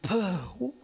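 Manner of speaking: a held-back, strained puh; choking back a word with effort. Retold or imitated. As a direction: force out a stifled half-word. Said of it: "I muster all of my strength, and I go".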